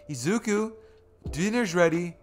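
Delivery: lilting motherly call from another room. Acted. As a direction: call them to dinner like a mom. Mother calling in a memory.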